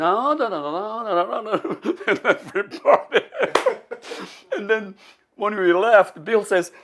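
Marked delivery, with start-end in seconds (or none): 0.0-1.9 "no, no, no" in high swooping runs; exaggerated intonation. Shown as a demonstration. Man imitating the accent's tune for laughs, "no, no, no" swung high. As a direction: chant "no, no, no" up and down, laughing at the end. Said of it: "You know, the intonation".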